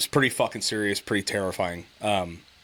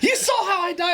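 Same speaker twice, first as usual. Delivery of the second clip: very high, squeaky through laughter; laughing mom quote; 4 dB louder than usual. Retold or imitated. Son retells mom's question, laughing in a high voice. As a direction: laugh out her shocked question. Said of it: "she's like".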